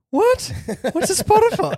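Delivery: very high, very loud squawk of disbelief; baffled shock. Retold or imitated. Sensei stunned by a streaming app, loud high shriek. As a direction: shriek it like the word is alien.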